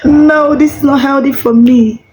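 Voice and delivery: silly tummy voice. Pretend. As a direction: play the tummy complaining, silly and light. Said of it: "Your tummy will be like".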